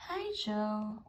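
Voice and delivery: a sighing, weary little greeting. A narrator's reading. Gabby greeting her friend Joe, sighing sadly. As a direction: let the words sag out on a sigh. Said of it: "Gabby said with a sigh".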